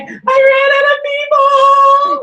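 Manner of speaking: high, slow, wailing self-pity; whiny wail. Retold or imitated. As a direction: wail it like a sad puppy. Mimics sad self in studio.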